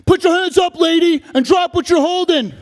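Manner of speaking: armed officer screaming orders; police shout. Acted. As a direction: yell it like a cop in a raid. Cops yelling at mom.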